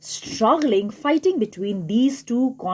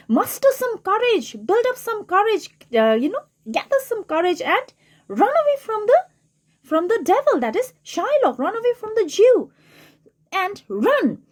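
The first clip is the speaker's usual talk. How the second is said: fiend urging; 8 semitones higher than usual. Acted. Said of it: "the fiend also asks him".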